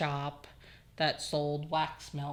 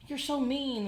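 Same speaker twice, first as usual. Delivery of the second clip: put-on high whiny kid voice; whiny toddler; 9 semitones higher than usual. Toddler told to get off computer, high whine. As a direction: whine like a sulking little boy. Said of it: "he'd be like".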